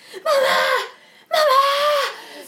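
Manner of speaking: high, loud panicked cry for mum; child with night terror screaming for his mother, high and loud. Retold or imitated. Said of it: "Skriker så här".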